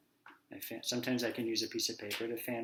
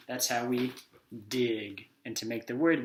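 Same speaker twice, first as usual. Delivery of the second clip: ordinary talk, nothing marked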